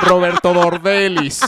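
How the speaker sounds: loud mock announcement; character impression. Host joking about being a character himself, mock announcing. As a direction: call the name out theatrically. Performs a character name for laughs.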